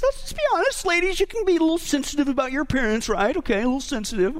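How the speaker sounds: high, put-on voice; mock delicate. Preacher teases ladies about their appearance, mock delicate. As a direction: pitch it way up, teasing the ladies.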